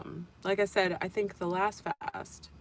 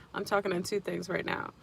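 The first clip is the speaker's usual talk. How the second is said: ordinary delivery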